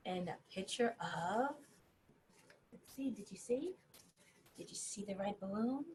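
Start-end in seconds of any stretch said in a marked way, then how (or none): none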